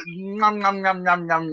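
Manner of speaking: cartoon gobbling, nom-nom-nom; mock munching noises. Mover gobbling muscle relaxants, exaggerated cartoon munching. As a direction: chomp greedily through the syllables.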